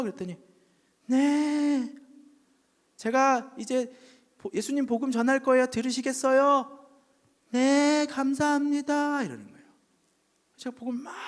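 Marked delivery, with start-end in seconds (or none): 1.1-9.2 high, louder, slowed retelling of the bedside talk; acted dialogue. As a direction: speak it like the bedside scene. Pastor and husband dialogue. (병상 대화를 재현하는 목소리)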